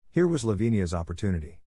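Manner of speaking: usual speaking voice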